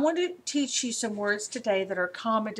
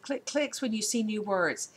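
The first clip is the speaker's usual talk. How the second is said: ordinary talk, nothing marked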